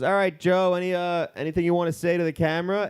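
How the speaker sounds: a lightly voiced instructor hyping for the camera; chirpy instructor. Imitating the skydive instructor.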